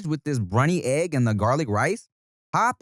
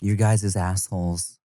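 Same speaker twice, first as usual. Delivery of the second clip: slow, lower, sultry mock of a woman's comment; 6 semitones lower, slower than usual. Host mimicking a producer's comment, slow mock seductive voice. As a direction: drag it out like a put-on seductive woman. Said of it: "I don't think she said it like that".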